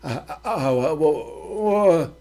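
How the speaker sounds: stammering, stuck on each sound; panicked stutter, words won't come. Acted.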